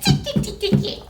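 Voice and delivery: high, giggly monkey tick-ticking; monkey chatter. Acted. Monkeys tease the peddler back, high, laughing chatter. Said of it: "and said".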